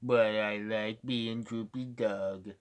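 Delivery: slow, sluggish cartoon hound; Droopy Dog. Shown as a demonstration. Streamer showing off impressions, slow Droopy Dog voice. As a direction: drag it out slowly and quietly. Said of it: "I can do a pretty good droopy dog".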